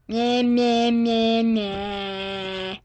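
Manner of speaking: taunting meh meh in a put-on voice; mock the camera with nonsense; mocking blah blah. Mocking noises making faces.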